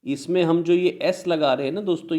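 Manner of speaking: usual speaking voice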